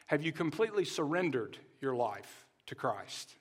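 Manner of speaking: hushed, earnest altar-call question; soft personal question. Acted. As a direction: lean in and ask it quietly. Pastor's pointed personal appeal.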